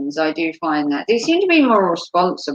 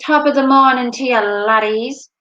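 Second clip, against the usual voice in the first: put-on Irish greeting, pitched higher; Irish accent; 4 semitones higher, 3 dB louder than usual. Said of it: "my Irish thing".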